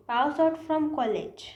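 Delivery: teacher presenting the error slowly; slow wrong example. Shown as a demonstration. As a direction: pass out from college drawn out slowly. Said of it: "गलत English".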